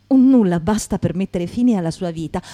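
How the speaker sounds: usual speaking voice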